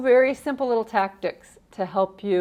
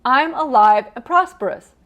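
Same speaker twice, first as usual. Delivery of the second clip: loud, higher-pitched proud mantra; enthusiastic affirmation; 5 semitones higher, 8 dB louder than usual. Shown as a demonstration. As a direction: proclaim it with gusto. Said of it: "Say it with some enthusiasm".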